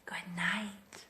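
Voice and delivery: soft unvoiced whisper. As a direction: breathe it out as a whisper. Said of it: "They whisper".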